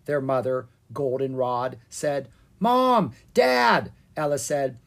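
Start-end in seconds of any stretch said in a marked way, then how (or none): 2.6-3.9 loud, high greeting call. Acted. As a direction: call out to your parents. Kids calling parents on arrival.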